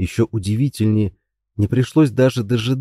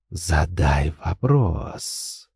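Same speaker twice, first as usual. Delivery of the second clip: very slow, low, soft and creeping; rustling insinuating voice; 6 semitones lower, 4 dB quieter, much slower than usual. Acted. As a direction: coax it out like rustling leaves. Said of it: "шелестящий вкрадчивый голос". (шелестящий вкрадчивый голос)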